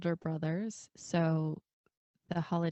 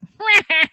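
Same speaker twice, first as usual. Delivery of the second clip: gleeful squealing laugh, much louder; loud high cackle; 17 dB louder than usual. Retold or imitated. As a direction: cackle like a brother whose prank worked. Brother laughs at the prank.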